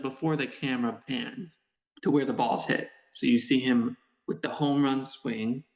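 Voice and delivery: normal talking voice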